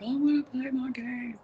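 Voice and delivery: retold tantrum in a put-on kid voice; screaming child. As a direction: imitate a child hollering in protest. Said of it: "he was screaming at the top of his lungs".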